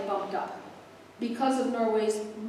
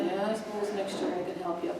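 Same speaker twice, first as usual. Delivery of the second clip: low-energy, softly grudging agreement; mock reluctant mumble; reluctant volunteer; 3 semitones lower, 8 dB quieter than usual. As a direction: imitate someone half-heartedly offering to help, voice dropped and quiet. Mimics reluctant committee volunteer.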